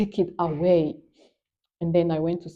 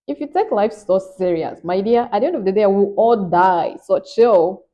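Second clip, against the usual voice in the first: louder, quick Nigerian accent, slowing on the last words; 6 dB louder than usual. Real, not acted. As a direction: let the Nigerian accent come out, louder and playful. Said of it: "Now that's the Nigerian accent coming out".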